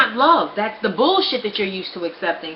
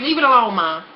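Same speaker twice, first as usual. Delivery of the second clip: exasperated; 2 semitones higher than usual. Retold or imitated. Annoyed reply to nosy mother.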